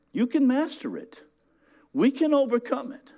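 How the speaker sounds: high, ringing, emphatic preaching; full force preaching. Acted. Pastor rousing congregation about righteousness, high fervent preaching. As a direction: drive every phrase home to the crowd.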